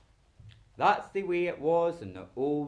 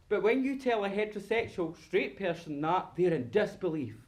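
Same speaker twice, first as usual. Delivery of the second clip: ordinary delivery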